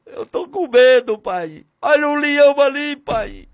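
David crying out in fear to his father; scared boy. Acted. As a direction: plead like a scared little shepherd boy. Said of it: "Ele vai mesmo com medo, dizendo".

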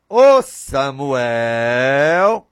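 long drawn-out call of a name; a slow, lingering summons. Acted. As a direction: call slowly, stretching the last syllable. God calling Samuel, drawn out.

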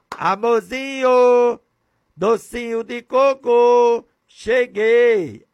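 high, slow, honeyed pet names; sweet playful greeting. Acted. Husband comes home and calls his wife pet names, high and sugary. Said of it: "eu vou contar uma história a você".

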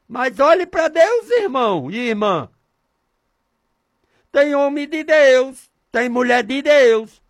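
loud, high preacher's exhortation; fervent preaching. Real, not acted. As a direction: preach it out with force to the congregation. Preaches at full force. (pregação fervorosa, alta e forte)

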